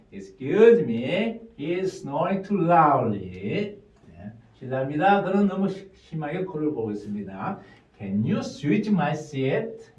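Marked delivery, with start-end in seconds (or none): 0.1-3.8 slow, deliberate and a bit louder; slow model sentence. Shown as a demonstration. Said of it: "자, 다시요". 8.0-9.9 slow, as one unit; slow whole sentence. Shown as a demonstration. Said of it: "한 문장 통째로 해야".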